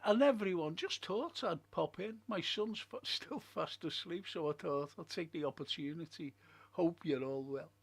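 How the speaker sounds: normal talking voice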